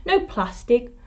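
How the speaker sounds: ordinary delivery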